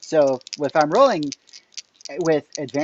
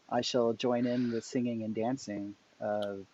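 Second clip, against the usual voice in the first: put-on American Cockney accent; 3 semitones lower, 10 dB quieter than usual. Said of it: "in my best Cockney, American Cockney accent".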